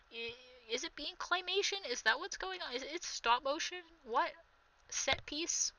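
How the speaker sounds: ordinary talk, nothing marked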